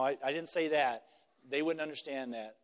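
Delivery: ordinary talk, nothing marked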